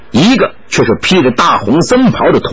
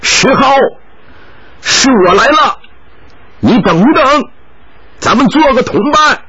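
higher calls after a man ahead on the trail; raised voice calling after a companion; 9 semitones higher than usual. Acted. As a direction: shout ahead to make him wait. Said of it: "卓一航叫道".

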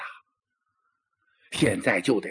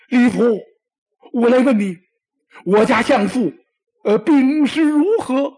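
high, loud, strained pleading; desperate questions to the messenger; anxious emperor; 10 semitones higher, 9 dB louder than usual. Acted. Emperor crying out high and loud for news of the chancellor.

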